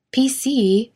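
mildly exasperated snap on the name; snapping. A narrator's reading. Embarrassed Mac cuts PC's teasing short, mildly snapping. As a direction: raise the pitch and snap it lightly. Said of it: "Mac snapped".

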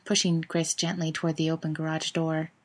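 usual speaking voice

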